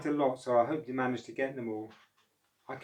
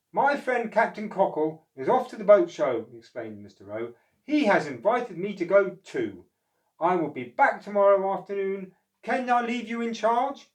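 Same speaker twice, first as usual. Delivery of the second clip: higher, louder cheerful boss; Mister O voice; 7 semitones higher, 8 dB louder than usual. Acted. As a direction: explain the trip and ask Oscar. Mister O explains.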